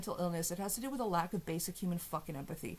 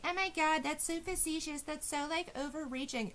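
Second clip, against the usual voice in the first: high, whiny mock of her defenders; mocking high-pitched defender voice; 8 semitones higher than usual. Mocking her defenders in a high whiny voice. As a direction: squeal it like a prissy fan mocking them. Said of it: "Her defenders are gonna be like".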